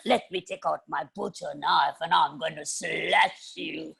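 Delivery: slow, gloating witch with cackles; creepy witch. Acted. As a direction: savor the threat slowly. Witch threatens old One Eye.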